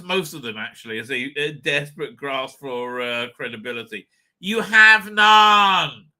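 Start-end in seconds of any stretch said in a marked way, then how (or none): 4.4-6.1 slow, loud mocking shout; drawn-out jeering shout. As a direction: draw it out and shout it at the show. Mocking the show's grasp for credibility.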